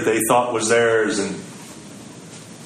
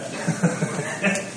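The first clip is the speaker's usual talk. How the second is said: soft airy chuckle; breathy unvoiced giggle; 2 semitones higher, 7 dB quieter than usual. Preacher laughing at the drunk guy's reaction, breathy chuckle. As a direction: let out a quiet breathy laugh.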